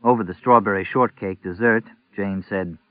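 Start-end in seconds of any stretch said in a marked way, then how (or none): none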